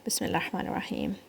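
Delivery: fast whispered basmala; hushed basmala. Real, not acted. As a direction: breathe it out under the breath. Teacher whispers basmala before reciting.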